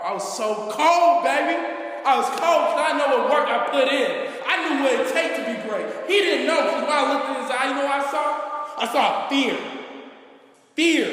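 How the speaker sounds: a loud, high, cocky brag; hyped up. Real, not acted. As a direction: savor the moment of triumph. Hyped climax of story.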